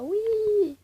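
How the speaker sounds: high, loud, drawn-out cheer; gleeful squeal. Real, not acted. Player showing viewers easy money, gleeful squeal. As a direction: squeal it with delight.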